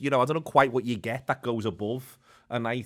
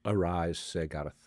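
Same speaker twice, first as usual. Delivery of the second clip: solemn regal knighting; 7 semitones lower, 4 dB quieter than usual. Pretend. Mock knighting ceremony.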